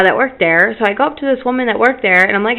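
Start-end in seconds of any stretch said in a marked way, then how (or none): none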